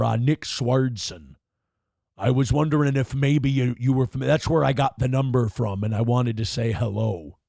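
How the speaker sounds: conversational wrestler impression, slowing on hesitations; Jesse Ventura. Comedian rehearses a prank call to Rick, wrestler impression. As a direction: chat like an old acquaintance in a put-on voice. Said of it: "People are saying Jesse Ventura".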